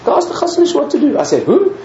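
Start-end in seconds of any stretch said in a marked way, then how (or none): 0.0-1.2 put-on high, excited old nurse voice; pitched-up old nurse pushing him to go. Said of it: "An old nurse walked past and she said to me". 1.4-1.7 high, startled "Who?"; a puzzled question, pitched way up; surprised squeak; baffled. Retold or imitated. Driver baffled by the nurse.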